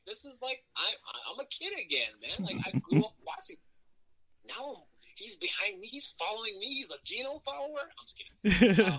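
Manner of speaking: put-on high, excited kid-again gush; giddy fan. Wrestler gushing about walking Goldberg out, giddy and high.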